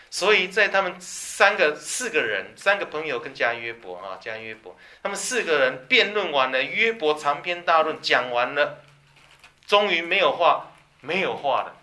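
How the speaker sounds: loud, slowing preacher climax; full-force preaching. Acted. Preacher raising his voice to the congregation about the debate ending. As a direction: drive the point home to the congregation.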